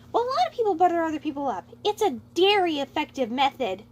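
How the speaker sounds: innocently giddy reply; giddy Lemmy voice. Acted.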